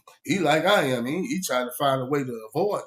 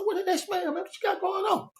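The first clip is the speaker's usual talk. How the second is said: curious surprise; 3 dB quieter than usual. Retold or imitated. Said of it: "So I said".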